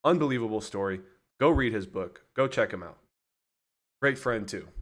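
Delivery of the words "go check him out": ordinary delivery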